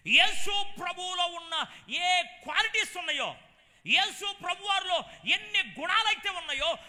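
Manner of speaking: loud, high preaching shout, stretched slow on same; fervent preaching. Acted. Preacher proclaiming the villagers' tribute to the man of God, loud and high.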